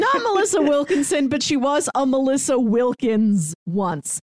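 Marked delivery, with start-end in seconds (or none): 0.0-1.3 laughing. Real, not acted. Laughs at the name mix-up.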